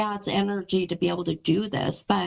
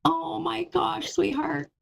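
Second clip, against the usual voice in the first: real emotion rising in the voice, pitch lifting; moved almost to tears, but still talking; getting choked up; 8 semitones higher than usual. Real, not acted. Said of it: "I'm gonna get choked up".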